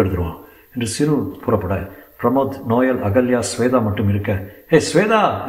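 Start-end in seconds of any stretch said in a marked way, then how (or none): 4.7-5.5 higher-pitched call. A narrator's reading. Someone outside summons Swetha, calling out. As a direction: call her name from a distance. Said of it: "என்று குரல் கேட்டு".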